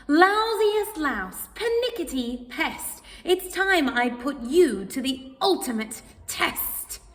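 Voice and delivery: cat daring the flea; angry challenge. Acted. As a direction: throw down the challenge.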